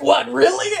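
loud, high disbelief; surprised. Retold or imitated. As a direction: blurt it out in shock. Quoting the character's reaction.

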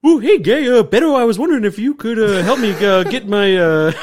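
loud, high, hammed-up Canadian-Spanish mix; badly faked Canadian with some Spanish, belted out; attempted Canadian-Spanish accent. Shown as a demonstration. Host trying an improv game, loud attempted Canadian-Spanish accent. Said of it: "So Canadian Spanish".

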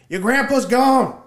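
high, loud, slow cry that he's gone; Danny yelling over the boat noise, panicked and loud; panicked shout. Retold or imitated. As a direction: shout the bad news in panic. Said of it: "And Danny said".

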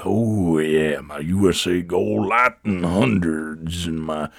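slow, low, put-on customer voice; store customer voice. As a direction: drag out the order like a sluggish regular. Plays slow convenience-store customer.

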